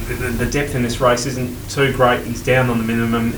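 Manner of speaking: ordinary talk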